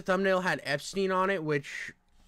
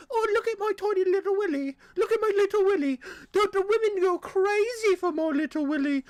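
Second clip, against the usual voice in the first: high, hammed-up posh British voice; a squeaky, boastful mock Englishman; 13 semitones higher, 3 dB louder than usual. Streamer mocks British men for chat, in a high silly British voice. Mocking Brits.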